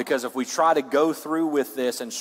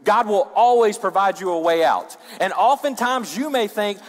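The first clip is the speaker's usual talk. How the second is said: raised, high preacher's voice; forceful preaching; 9 semitones higher, 6 dB louder than usual. Real, not acted. Preacher warning the congregation about temptation, high and forceful.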